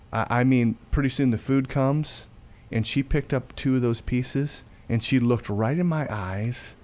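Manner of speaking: ordinary talk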